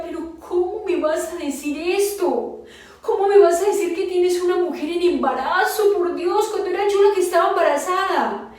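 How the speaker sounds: loud, high, anguished protest; retold confrontation, building to a high cry of disbelief; outraged outburst. Wife learning another woman is pregnant, outraged and anguished.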